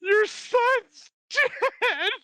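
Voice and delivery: drawn-out high wail with a laugh; hammed-up sobbing mother, cracking up; mock wail, laughing. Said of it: "And she's like".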